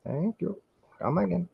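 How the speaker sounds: high, soft Apu impression; singsong shopkeeper farewell. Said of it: "sounding like a poo from The Simpsons".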